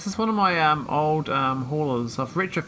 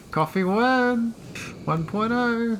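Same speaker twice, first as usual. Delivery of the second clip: high, drawn-out posh voice; mock posh; 8 semitones higher, slower than usual. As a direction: put on a posh voice, pitched up and slowed. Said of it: "He, he sounds posh".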